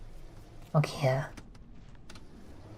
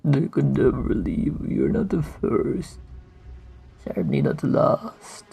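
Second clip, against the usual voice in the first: creepy voice; 4 dB quieter than usual. Acted. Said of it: "Creepy voice".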